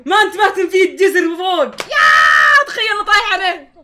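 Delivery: loud, high, drawn-out complaint; exasperated yelling. Real, not acted. Player missed what was above, exasperated shouting. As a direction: yell it in disbelief at the blunder.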